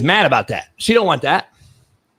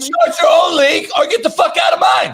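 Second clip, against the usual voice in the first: high shouted ultimatum; shouted demand; 9 semitones higher than usual. Real, not acted. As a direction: yell the ultimatum. Heated rant, laughing at end.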